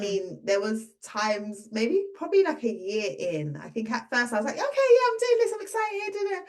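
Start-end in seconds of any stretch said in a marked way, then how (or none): 4.5-6.4 high-pitched, giddy burst of enthusiasm; excited. Retold or imitated. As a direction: act out being thrilled to get started. Retells her initial excitement.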